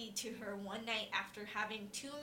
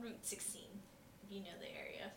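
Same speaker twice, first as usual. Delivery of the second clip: whispered aside; 3 semitones lower, 14 dB quieter, faster than usual. Real, not acted. Quiet aside about local roads.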